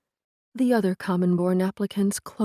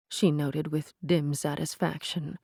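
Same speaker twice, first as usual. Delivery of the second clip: usual speaking voice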